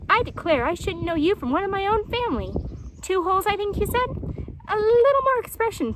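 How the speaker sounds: a cheeky hedgehog coaching his friend; lightly voiced teasing hedgehog. Stickly Prickly teasing tortoise.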